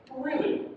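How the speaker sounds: "Really" pitched high, then falling; falling intonation. Shown as a demonstration. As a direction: start "Really" high and let it drop. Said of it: "Yeah, that's falling intonation".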